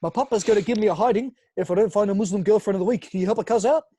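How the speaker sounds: high, loud put-on Turkish-Australian lad; Yilmaz. Prankster begging strangers to find him a girlfriend, loud comic lad. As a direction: plead in a hammed-up Turkish-Australian street voice. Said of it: "I pretend to be Yilmaz".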